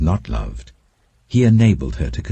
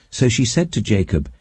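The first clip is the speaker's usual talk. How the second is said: ordinary delivery, nothing marked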